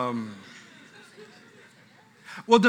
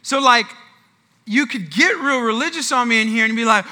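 ordinary talk

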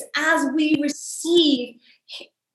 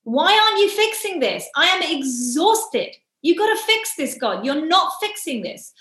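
exasperated, higher-pitched demand; fed-up pleading, pitched up; unpleasant to God; 4 semitones higher than usual. Retold or imitated. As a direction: re-enact an exhausted, cross complaint to God. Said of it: "I wasn't very pleasant to God either".

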